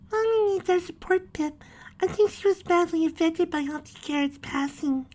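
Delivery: put-on very high, small child voice; little filly voice. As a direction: speak as a little girl pony.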